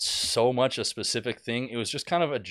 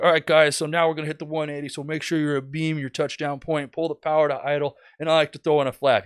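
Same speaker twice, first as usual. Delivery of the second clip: higher-pitched instructor talking viewers through it; mock how-to presenter, brisk and raised; video tutorial host; 6 semitones higher, 5 dB louder than usual. Video pilot explaining the maneuver from the plane, presenter voice. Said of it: "He's like".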